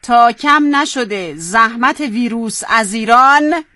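slow, drawn-out line for repeating; feed the line slowly, word by word; slow dictation. Shown as a demonstration. Host dictating the line to the contestant, slow dictation.

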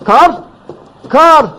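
high, loud, elated calling; excited shouting. Acted. Deputy calling the father back with news, excited shouts. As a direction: call out urgently with joyful news.

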